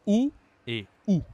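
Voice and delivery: both ou identical, high and loud; same sound. Shown as a demonstration. Teacher shows two spellings sound alike, high loud ou both times. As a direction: punch each ou as the same rounded vowel. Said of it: "C'est la même prononciation ici. On dit".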